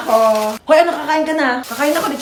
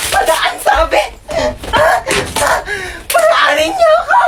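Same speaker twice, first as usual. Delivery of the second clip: mock yell. Blocked from passing, yells.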